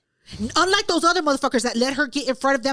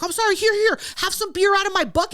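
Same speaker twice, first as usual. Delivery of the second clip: higher-pitched mock placating; imitated eager apology; 6 semitones higher, 3 dB louder than usual. Random guy offering her beer to make up, placating. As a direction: imitate a guy making amends in a hurry.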